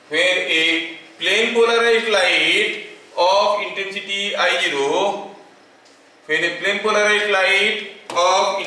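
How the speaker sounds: word by word, very slow dictation with long pauses; teacher dictating a law for notes. Acted. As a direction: dictate to students taking notes.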